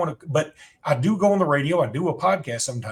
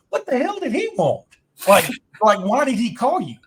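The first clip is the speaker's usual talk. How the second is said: mom's confused question; 9 semitones higher, 4 dB louder than usual. Retold or imitated. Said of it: "And my mom goes".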